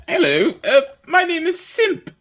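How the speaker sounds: very high, squeaky little kobold. Acted. Simp the kobold greeting the party in a squeaky high voice. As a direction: squeak it like a tiny eager lizard. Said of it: "what a kobold looks like".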